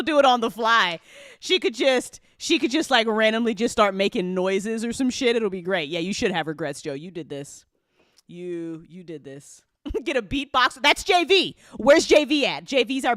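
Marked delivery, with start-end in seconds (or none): none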